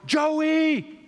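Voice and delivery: high, loud call across the house. Retold or imitated. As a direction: yell for the kid to come here. Parent calls the other kid.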